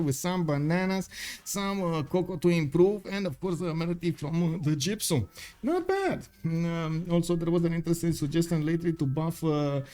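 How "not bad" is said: mock-impressed squeak. Real, not acted. Caster teasing a player's start, squeaky mock praise. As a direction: jump way up high on not bad.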